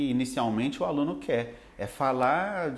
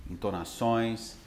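ordinary talk, nothing marked

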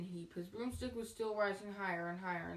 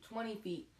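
usual speaking voice

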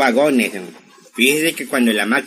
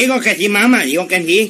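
higher, flustered reassurance to mother; hasty backtracking; 6 semitones higher, 3 dB louder than usual. Acted. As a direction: cover up the muttered remark, pitched up. Corrige lo que murmuró. (rectificando apurado, con voz más aguda)

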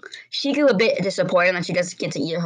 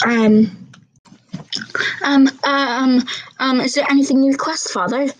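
nervous; 7 semitones higher, 5 dB louder than usual. Acted. Scared daughter answering abusive father.

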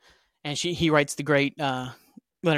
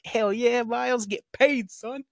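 cheering Miles; 7 semitones higher, slower than usual. Retold or imitated. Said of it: "It's like".

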